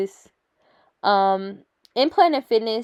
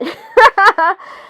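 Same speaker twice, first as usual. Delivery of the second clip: high laugh at herself; real giggle about her sleepy face; bright burst of laughter; 10 semitones higher, 8 dB louder than usual. Real, not acted. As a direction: laugh it off, tired and amused. Laughing about being tired.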